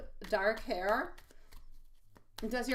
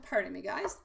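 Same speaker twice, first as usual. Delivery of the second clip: ordinary talk, nothing marked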